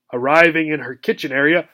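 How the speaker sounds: usual speaking voice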